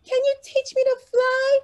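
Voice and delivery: very high, hopeful little bird question; a tiny bird asking a new friend; small bird voice. Acted. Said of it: "asked Birdie".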